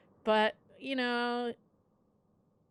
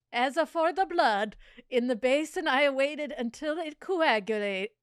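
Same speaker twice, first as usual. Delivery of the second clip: hammed-up mock Italian accent, a bit higher and louder; 5 semitones higher, 5 dB louder than usual. Reading the killer's memoir to her cohost, mock Italian accent. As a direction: read it in a playful put-on Italian accent. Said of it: "Should I be doing this in an Italian accent?".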